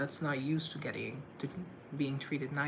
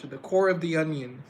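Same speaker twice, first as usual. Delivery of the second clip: Coolidge echo; 2 semitones higher, 9 dB louder than usual. Retold or imitated. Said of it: "I relate to that so much".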